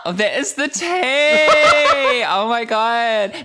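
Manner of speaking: high, loud, drawn-out squeal through laughter; delighted shriek. Real, not acted. Host reacting to a pointed hormone question, delighted shriek. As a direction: shriek it slowly, scandalised and laughing.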